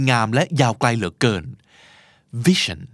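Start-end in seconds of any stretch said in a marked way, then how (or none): 2.5-2.8 vision with VI stressed and sion reduced; English stress. Shown as a demonstration. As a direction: stress VI, let sion fade. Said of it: "ภาษาไทย vision". (เน้น VI ส่วน sion เบา)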